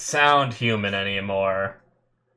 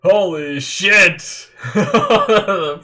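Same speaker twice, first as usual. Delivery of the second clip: slow, loud drawn-out curse, higher; shocked yell; 7 semitones higher, 6 dB louder, much slower than usual. Real, not acted. Fan watching the warping attack, loud stretched-out curse. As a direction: blown away all over again.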